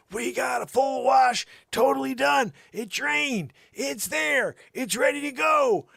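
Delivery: very high, loud hammed-up announcement; shouting. Announcing the finished laundry, hammed-up shouting. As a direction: boast it to the house.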